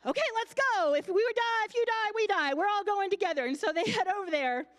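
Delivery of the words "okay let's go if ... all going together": high, loud, gung-ho Thomas; eager Thomas. Retold or imitated. Thomas rallying the disciples, high and loud. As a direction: bark it out like a keen disciple ready to go. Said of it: "And Thomas is like".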